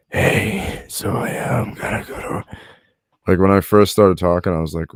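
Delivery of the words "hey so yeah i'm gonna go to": airless, pained whisper, struggling for breath; breathless strained voice. Shown as a demonstration. Showing friend how chest pain choked his voice. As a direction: squeeze the words out with no air. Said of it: "it was like this".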